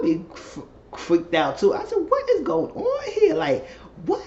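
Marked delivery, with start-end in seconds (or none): 2.1-4.2 drawn-out squeaky what; high slow disbelief. Retold or imitated. Retelling her own reaction, slow high disbelief.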